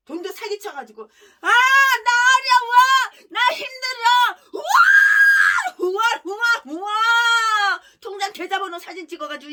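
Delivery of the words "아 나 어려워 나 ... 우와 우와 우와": high, loud, drawn-out fake wailing; mock whining plea. Pastor begging strangers for donations, hammed-up wailing. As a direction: wail like a beggar faking hardship.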